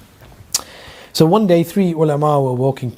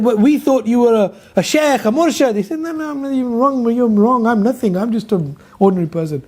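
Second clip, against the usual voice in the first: protesting students; 6 semitones higher than usual. Acted. Ulama protest, Ghazali denies.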